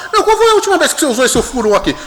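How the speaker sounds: quick, high-pitched amused question; curioso, retold question. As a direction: fire off the question, high and incredulous. Voices himself quizzing his rich colleague. (pergunta curiosa, rápida e aguda, recontada)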